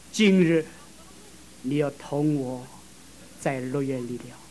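slow, soft, fading words of Jesus; tender promise. Acted. Jesus promising the thief paradise, tender and slow. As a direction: give the promise gently.

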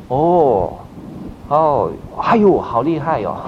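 slow, drawn-out impressed oohs; demonstrated listener's amazement, stretched out; supportive admiration. Shown as a demonstration. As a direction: encourage the speaker with wonder. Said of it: "有一点表情来支持他".

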